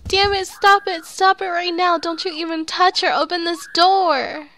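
high, loud frantic yelling; desperate shouting. Acted. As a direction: pound the door and scream for her.

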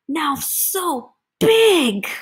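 high, slow, building emphasis; emphatic bigness. A narrator's reading. Reader shows children the grown cloud, big emphatic delivery. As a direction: swell slowly up to BIG.

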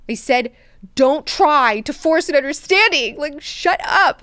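loud, high mock tirade of an irritated god-emperor; angry ruler. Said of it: "he's so angry".

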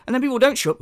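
ordinary delivery

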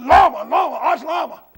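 loud burst as the name comes back; sudden recall. Real, not acted. Preacher suddenly remembers the banker's name, loud and excited.

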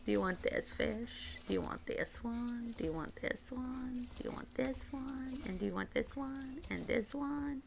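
soft coaxing. Pretend. Player offering fish after fish to a game character, soft coaxing. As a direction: offer each one softly, in the same lilt.

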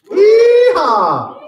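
very high, loud cowboy whoop; loud Texan yeehaw; yeehaw shout. Real, not acted. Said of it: "Raise your hand".

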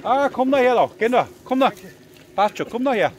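laut, höher: komm da her; lockend rufen. Real, not acted. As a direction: dem Auto zurufen. Calling the RC car over. (lautes Herrufen)

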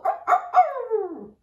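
high, slow begging woofs; puppy begging bark. Shown as a demonstration. Teacher showing children how a puppy begs, high yapping woofs. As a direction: beg like a puppy for a bone. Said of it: "put your paws up and go".

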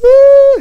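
hammed-up bawl of a crying child; mock wail. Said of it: "My two friends are just crying".

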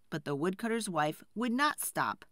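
ordinary delivery, nothing marked

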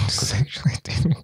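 whispered laugh. Real, not acted. Laughing repeat.

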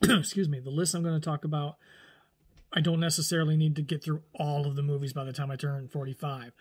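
normal talking voice

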